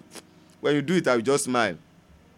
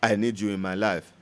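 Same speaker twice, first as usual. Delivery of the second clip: quiet prayer; 6 semitones lower, 3 dB quieter than usual. Acted. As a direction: pray it softly and earnestly.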